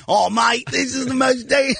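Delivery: high-pitched, failed Australian accent; attempted Australian accent. Shown as a demonstration. Comedian retries zoo guy's line for the host, attempted Australian accent. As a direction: shout it in a badly faked Australian accent. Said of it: "It doesn't sound like an Australian guy. Could I hear it again".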